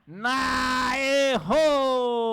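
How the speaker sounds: loud teasing "wrong!"; playful shout. Acted. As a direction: call out the miss gleefully. Anuncia o erro da boneca. (grito brincalhão)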